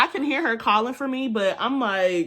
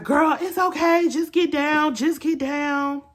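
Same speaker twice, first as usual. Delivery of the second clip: calm stranger urging; 7 semitones higher than usual. Retold or imitated. Stranger girl tells her to duck.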